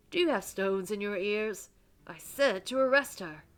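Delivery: angry command. Acted. Reverend berating his weapon for not arresting her, angrily. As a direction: berate a disobedient underling.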